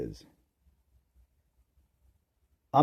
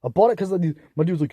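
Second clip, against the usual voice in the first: ordinary talk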